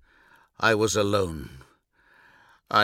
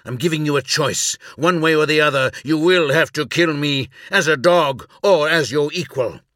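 higher, louder insistence; forceful ultimatum; 5 semitones higher, 5 dB louder than usual. Acted. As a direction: drive the ultimatum home with mounting force. Captive insists on a deadly choice.

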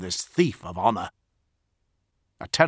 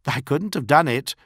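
lofty and condescending, a touch louder; supercilious; 5 semitones higher, 5 dB louder than usual. A narrator's reading. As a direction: talk down to him with false thanks. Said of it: "at the supercilious tone".

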